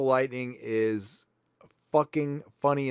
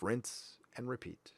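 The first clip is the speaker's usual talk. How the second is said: slow breathy whisper; whispered aside; 3 semitones lower, 18 dB quieter than usual. Real, not acted. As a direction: drop to a whisper, slowly. Weary aside after the rant.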